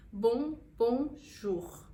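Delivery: "bon, bonjour" split and very slow, nasal bon then a throaty R; French bonjour, slowly. Shown as a demonstration. Teacher slowly breaks down bonjour, careful French pronunciation. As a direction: break it down syllable by syllable. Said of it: "o R é bem puxadinho".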